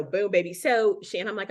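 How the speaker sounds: ordinary talk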